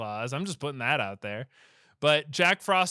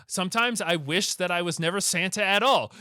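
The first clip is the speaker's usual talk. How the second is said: raised, louder mock declaration; Santa's fateful wish; 5 semitones higher, 7 dB louder than usual. Santa holding the orb, mock-dramatic declaration. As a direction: declare the wish aloud, pitched up. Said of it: "He's like".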